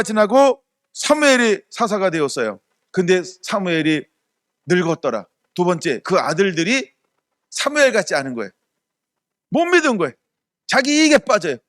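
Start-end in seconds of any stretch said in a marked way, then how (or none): none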